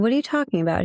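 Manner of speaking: mildly acted bafflement at an accusation; retold disbelief. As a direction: replay a taken-aback question, pitch lifted. Retold baffled reply to ex's accusation.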